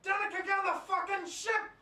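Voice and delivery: loud, high urgent yell; shouting. Real, not acted. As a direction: bark the order across the room. Player yells at teammate.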